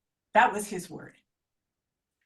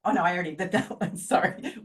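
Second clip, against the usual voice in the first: ordinary talk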